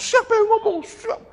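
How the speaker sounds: very high-pitched mock outrage; mimicked raging. Offended pastor demands who spread the rumour, mock raging. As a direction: imitate an enraged man in a squeezed high voice.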